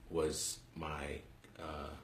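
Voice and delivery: ordinary delivery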